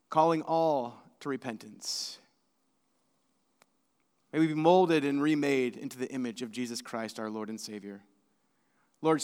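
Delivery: low, soft, measured prayer voice; hushed pastoral prayer, fading to a quiet amen. Real, not acted. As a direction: pray gently and reverently, pausing between petitions. Leads a prayer.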